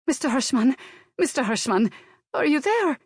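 put-on high-pitched Slavic-accented calling down the line; anxious Slavic accent. A narrator's reading. Maid checking employer is still on the phone, anxious Slavic accent. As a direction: call for him urgently in a Slavic accent. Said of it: "her strong Slavic accent".

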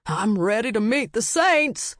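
rising, high-pitched proclamation; not-quite-sober declaration, building to a high cry; tipsy proclamation. A narrator's reading. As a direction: proclaim it to the sky. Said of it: "and proclaimed".

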